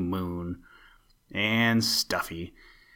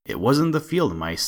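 a cheeky bird teasing, a touch louder; taunting sparrow; 5 semitones higher, 5 dB louder than usual. A narrator's reading. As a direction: taunt the rat from a safe branch.

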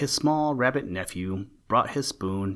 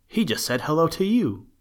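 high, louder little-rabbit shout; storybook shout, pitched up high; 8 semitones higher, 5 dB louder than usual. A narrator's reading. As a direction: call it out excitedly as a little rabbit. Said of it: "the little rabbit shouted".

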